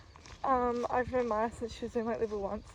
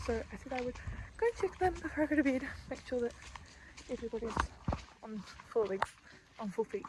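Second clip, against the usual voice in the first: ordinary talk, nothing marked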